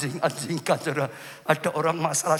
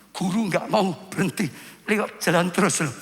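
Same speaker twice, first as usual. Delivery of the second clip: ordinary talk, nothing marked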